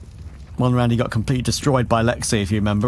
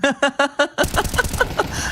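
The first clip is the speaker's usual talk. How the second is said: high laugh. Real, not acted. Laughs at own joke.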